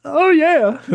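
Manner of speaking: very high, loud rock-star purr. Retold or imitated. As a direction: coo it like a glam rocker. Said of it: "get up to [name]".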